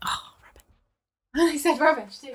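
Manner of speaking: laughing at the slip, high and soft; a helpless, squeaky laugh at yourself; rueful laughter. Real, not acted. Presenter realising a slip costs a drink, laughing ruefully.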